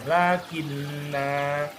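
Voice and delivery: very slow "Lakinna", final a held; lengthened ending. Shown as a demonstration. Teacher demonstrates lengthening, long held "Lakinna". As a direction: draw out the "na" of "Lakinna". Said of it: "memanjangkan kata sebelumnya".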